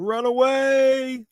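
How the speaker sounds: high, slow mock panic cry; a mock-heroic flee call, drawn out; hammed-up Monty Python run away; Monty Python quote. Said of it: "to quote Monty Python".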